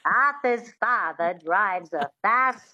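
slow transatlantic with witchy twang; twangy transatlantic. Shown as a demonstration. Coach returning to the practice sentence, twangy transatlantic accent. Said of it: "that twang is really important".

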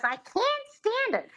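very high squeaky starlet imitation; high mimicking starlet; Lena Lamont voice. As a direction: squeal it back like a bad pupil. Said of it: "And she goes".

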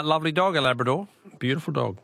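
normal talking voice

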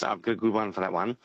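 ordinary talk, nothing marked

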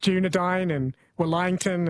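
Dunedain and Wellington with distorted vowels, as a machine might garble them; playfully mangled Dunedain and Wellington; jokey mispronunciation. Shown as a demonstration. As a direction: say Dunedain as "Dune-a-dine" and Wellington with a stretched middle. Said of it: "start mispronouncing the English names".

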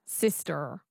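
standard American accent. Shown as a demonstration. Learner repeats after coach, standard American accent. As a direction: rhotic American Sister. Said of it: "So that's the American".

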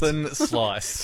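"Thin slice" with a flattened Kiwi vowel, like "thun sluce"; Kiwi accent. Retold or imitated. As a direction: say "Thin slice" the New Zealand way. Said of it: "mocking the New Zealand accent".

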